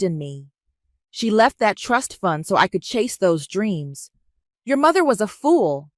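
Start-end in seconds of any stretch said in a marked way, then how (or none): 4.6-5.9 high-pitched, bitter outburst; mask shattering. A narrator's reading. Aunt insulting her niece's late mother, mask shattering. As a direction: let contempt break out, pitched up. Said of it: "Sandra's mask finally shattered".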